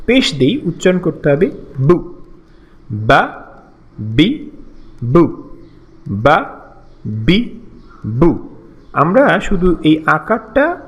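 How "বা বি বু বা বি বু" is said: বা, বি, বু as separate short syllables, lower pitched; short vowel drill. Shown as a demonstration. Teacher models Arabic short vowels, বা, বি, বু each brief and separate. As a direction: cut each vowel short in বা, বি, বু, pausing after each. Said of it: "করতে হবে খুব দ্রুত, স্বল্প".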